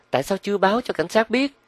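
impatient, piercingly high woman's voice; shrill voice. A narrator's reading. Impatient woman asks her partner again about police, shrilly. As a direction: repeat the question in a high screech. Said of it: "bằng một giọng the thé".

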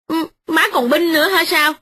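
wife sneering at her mother-in-law; sarcastic retort. Acted. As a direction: accuse her of taking sides.

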